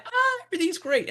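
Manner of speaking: high, airy voice of someone on top of the world; mock elation. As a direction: play the giddy high of the roller coaster. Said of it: "up on the high".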